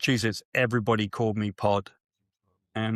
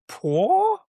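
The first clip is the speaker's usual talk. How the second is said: very high rising "Paul?"; squeaky baffled "Paul?"; mock confused question; 4 dB louder than usual. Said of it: "And she goes".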